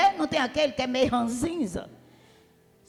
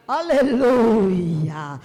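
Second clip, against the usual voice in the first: drawn-out aleluia; 5 semitones lower, 4 dB louder, much slower than usual. Real, not acted. Preacher praising God.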